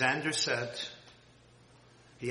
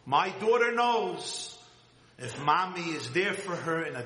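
louder, higher pulpit emphasis; emphatic preaching; 4 semitones higher, 5 dB louder than usual. Acted. Preaching build again.

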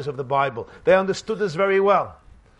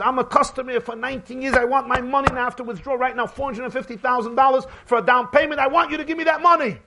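angry customer yelling; 7 semitones higher, faster than usual. Retold or imitated. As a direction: demand it at the top of your voice. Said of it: "And the guy says".

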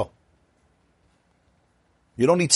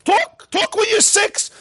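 urgent plea; slower than usual. Real, not acted. Urging listeners to speak early.

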